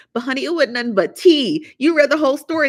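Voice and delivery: high, loud gossip reveal; excited. Real, not acted. Vlogger excitedly spilling tea to viewers.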